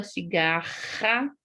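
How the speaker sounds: "cigarra" slowed with a rasped RR; drawn-out guttural R in "cigarra"; strong double R. Shown as a demonstration. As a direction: read "cigarra" stressing the throaty RR. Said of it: "olha os dois R's ali".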